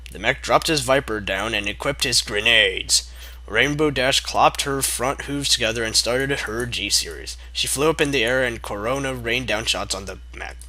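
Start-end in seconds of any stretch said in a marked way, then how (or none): none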